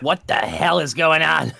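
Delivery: usual speaking voice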